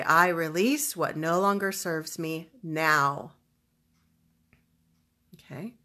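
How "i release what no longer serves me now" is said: slow, emphatic affirmation; deliberate, resolute statement; powerful declaration. Shown as a demonstration. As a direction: declare it firmly, giving each phrase weight. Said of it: "very emotionally powerful declaration".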